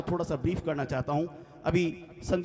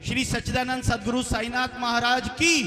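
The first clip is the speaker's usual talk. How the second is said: loud, higher chant-call to the crowd; rousing jaikara shout; devotional call-out; 8 semitones higher, 4 dB louder than usual. Real, not acted. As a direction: lead the hall in a rousing cheer, rising on की. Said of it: "जोरदार सभी मिलकर जयकारा लगाएंगे".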